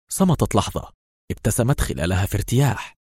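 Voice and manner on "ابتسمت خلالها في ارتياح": usual speaking voice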